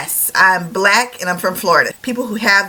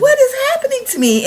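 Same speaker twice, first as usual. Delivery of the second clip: far higher, quick, delighted squeal; giddy mock amazement; faster than usual. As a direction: squeak it out, surprised by your own joy. Amazed at own happiness.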